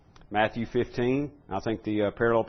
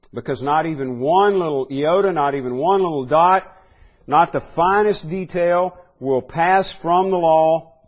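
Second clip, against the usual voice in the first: rising pulpit declamation; full-force preaching; 6 semitones higher, 8 dB louder than usual. Acted. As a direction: preach it, climbing to a ringing peak. Preacher quoting Jesus, rising.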